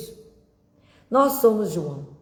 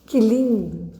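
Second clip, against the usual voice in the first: fuller, more emphatic exclamation; open admiration; 3 semitones higher, 5 dB louder, slower than usual. Real, not acted. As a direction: say QUE LINDO out loud with conviction. Repeats the admiring remark louder.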